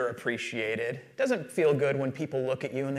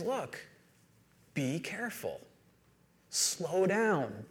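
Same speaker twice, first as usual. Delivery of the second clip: softly voiced, measured warning; Jesus's gentle caution; 2 semitones higher, 7 dB quieter, much slower than usual. Jesus warning listeners to be careful, soft and earnest. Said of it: "He says".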